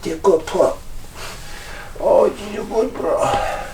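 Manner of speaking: high, soft sing-song praise for the dog. Real, not acted. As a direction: gush over the puppy gently.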